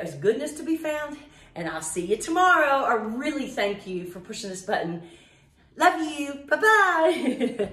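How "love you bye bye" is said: higher and louder, laughing; laughing goodbye. Real, not acted. The playful sign-off.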